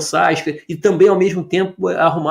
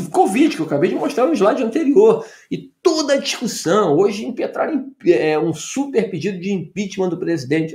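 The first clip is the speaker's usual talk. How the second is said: ordinary talk, nothing marked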